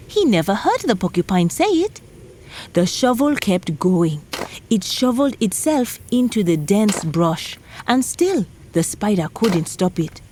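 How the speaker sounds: plain reading voice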